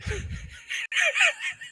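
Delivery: sudden high laugh bursting out; high burst of laughter. Real, not acted. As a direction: break into helpless laughter. Laughing at a chat message.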